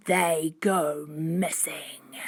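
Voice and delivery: low, very slow, whispery threat; deep, drawn-out sinister voice; menacing man. A narrator's reading. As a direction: draw it out darkly to scare kids.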